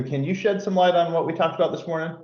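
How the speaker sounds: ordinary talk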